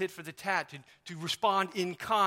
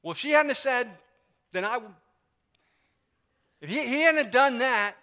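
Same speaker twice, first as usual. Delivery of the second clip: higher, louder, indignant whine; childish excuse; 4 semitones higher, 9 dB louder than usual. Retold or imitated. Preacher acting out a child's excuse, loud indignant whine. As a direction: protest like a kid caught out. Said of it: "we say that".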